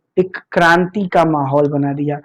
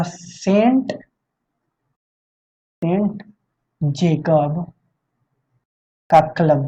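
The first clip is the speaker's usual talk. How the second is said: slow dictation; much slower than usual. Shown as a demonstration. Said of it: "dictating the club's name".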